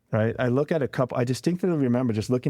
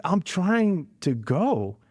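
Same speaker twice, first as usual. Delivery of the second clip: higher, drawn-out strained reply; stressed cousin; 6 semitones higher, much slower than usual. Retold or imitated. Cousin answering why they aren't gone, stressed and drawn out. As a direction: imitate a stressed driver, stretching the words out. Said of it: "the stress in his voice".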